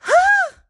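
very high, loud startled shriek; shrill scream. Acted. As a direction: let out a piercing bug-panic scream. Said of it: "that's not a war cry".

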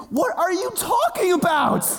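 very high, incredulous cry; exasperated panic. Retold or imitated. Scared son answering his soothing mom, exasperated panic. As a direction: re-enact frantic disbelief at the top of the range.

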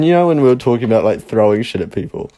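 ordinary talk, nothing marked

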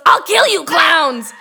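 hammed-up furious yelling, laughing through it; imitating an enraged shout. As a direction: shriek it like the crazed lady leaning out her window. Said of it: "And then she goes".

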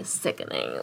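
breathy whisper. Retold or imitated. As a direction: hush it out admiringly. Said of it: "I was like".

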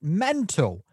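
"mental" punched high and loud; emphatic punchline. Real, not acted. Verdict on working with him, "mental" high and loud. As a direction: hold back, then burst out "mental".

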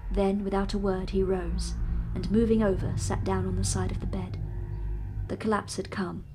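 steady, measured reading of a classic novel; story narration. A narrator's reading. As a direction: read it like an audiobook narrator. Narration resumes.

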